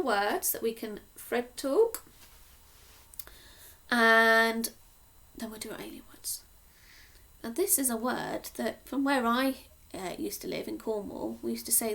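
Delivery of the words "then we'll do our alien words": quick hushed whisper. Real, not acted. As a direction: whisper it like a secret. Whispered aside to children.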